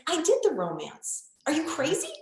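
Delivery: high, incredulous mock husband, laughing at the end; exasperated husband. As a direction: play the baffled guy, half laughing. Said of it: "And he's like".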